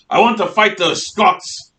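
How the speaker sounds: teacher slips into a hint of Scottish brogue; Scottish accent. Teacher playing a king, mock Scottish brogue. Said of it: "I'm talking like a Scot".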